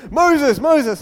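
loud, high booming call; booming loudspeaker voice. Acted. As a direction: boom the name out like a police loudspeaker. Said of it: "this booming voice with a loudspeaker".

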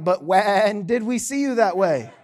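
comic sheep voice with a bleated "when"; bleating sheep. Acted. Sheep questioning Jesus in the preacher's parable, bleating. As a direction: draw WHEN out into a wobbly bleat. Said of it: "the sheep are gonna look at him and be like".

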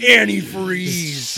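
loud, drawn-out echo; mock exclamation. Echoes the quoted movie line.

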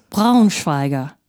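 "Braunschweiger" as BROWN-shvy-ger; German pronunciation. Shown as a demonstration. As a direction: say "Braunschweiger" with ow and shv. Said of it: "is pronounced Au".

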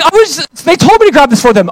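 high, loud flustered excuse; panicked excuse. Retold or imitated. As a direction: imitate a caught kid blurting an alibi. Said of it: "freak out".

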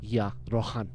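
low, hushed, self-satisfied sign-off; cool show-off. Retold or imitated. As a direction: imitate a cocky dancer playing it cool. Imitating the show-off after his stunt.

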